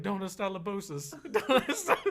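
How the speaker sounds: high, laughing broken Spanish; panicked Spanish. Retold or imitated. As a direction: fumble through Spanish in a panic. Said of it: "So I was like".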